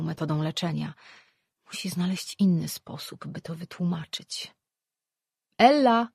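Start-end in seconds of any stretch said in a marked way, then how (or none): 5.6-6.1 loud, higher call of a name. A narrator's reading. Familiar voice calls the priestess from the corridor, calling out. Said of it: "zawołał z korytarza znajomy głos".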